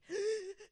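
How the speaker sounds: sharp high sob-gasp for air; a hammed-up sobbing intake of breath. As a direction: gasp like you can't catch your breath. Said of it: "that type of crying where you can't catch your breath".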